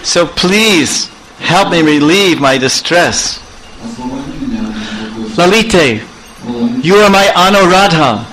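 high, pleading lover's voice, choked with emotion; Krishna pleads with Lalita at the gate, choked up. Acted. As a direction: beg Lalita through a tight throat. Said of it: "Krishna became all choked up".

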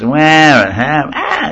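rising mock wail; demonstrative baby bawl; imitated baby crying. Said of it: "just the crying is all the same".

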